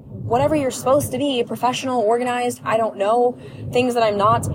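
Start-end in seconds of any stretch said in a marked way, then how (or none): none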